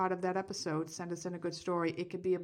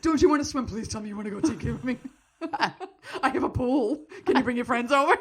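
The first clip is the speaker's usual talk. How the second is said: laughing, louder flirty come-on; flirty pool mom; 4 semitones higher, 6 dB louder than usual. Pretend. Imagining herself as a swim mom, laughing flirtatiously. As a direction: play a cougar luring the swimmers. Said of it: "I'm gonna be the mother".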